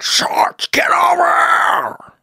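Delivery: very high strained slow mock yell; old man yelling at dog. Old man in the park yelling at his dog, high slow mock yell. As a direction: stretch out a shrill call across the park. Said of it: "And the man's like".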